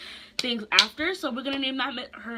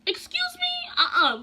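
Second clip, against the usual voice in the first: indignant shock; 6 dB louder than usual. Real, not acted. Outraged at accusation.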